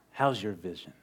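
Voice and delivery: low, soft, mildly menacing question; understated snarling jibe; imitated snarl. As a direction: mutter it low, like a hostile stranger. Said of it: "the man kind of snarls at him".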